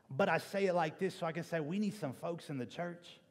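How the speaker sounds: usual speaking voice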